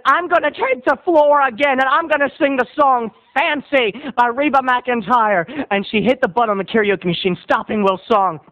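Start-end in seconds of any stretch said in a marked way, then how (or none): 0.1-5.7 high, hammed-up proclamation; defiant announcement. Karaoke regular retakes the floor, defiant. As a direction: claim the floor in a raised voice.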